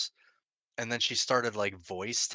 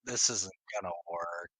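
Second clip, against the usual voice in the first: whispering; 5 semitones lower than usual. Retold or imitated. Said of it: "I'm like".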